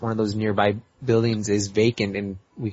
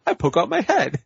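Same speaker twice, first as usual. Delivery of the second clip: high-pitched mock Italian call; imitated Italian accent; 8 semitones higher than usual. Final guy sticking his head out the window, mock Italian accent. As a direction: announce it as a cartoon Italian neighbor. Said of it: "this final guy ends it by sticking his head and goes".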